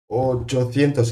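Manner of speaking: "Ochocientos" drawn out slow and high; high, slow emphasis on "Ochocientos"; emphatic marvelling number. Real, not acted. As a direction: raise and stretch "Ochocientos" to stress its size. Marvels at how large the number is.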